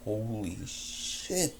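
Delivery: plain reading voice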